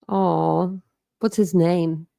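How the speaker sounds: ordinary delivery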